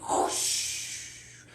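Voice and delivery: long breathy whoosh; whooshing rain. Acted. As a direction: blow out a rushing rain sound. Wizard makes rain.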